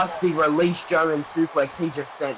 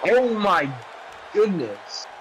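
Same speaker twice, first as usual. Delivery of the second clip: amazed; 6 semitones higher, much slower than usual. Real, not acted. Amazed at the Phoenix Splash.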